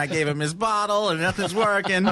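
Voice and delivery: put-on high, loud, flustered Boston accent. Dad imitating the Boston foster mom, in a flustered Boston accent. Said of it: "the foster mom and her Boston accent".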